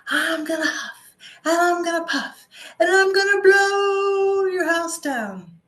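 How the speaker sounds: high, loud, swelling wolf threat; big bad wolf. Wolf threat in fairy tale.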